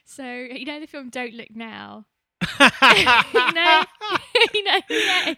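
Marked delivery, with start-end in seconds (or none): 2.4-5.4 laughing. Real, not acted. Bursts out laughing at the film.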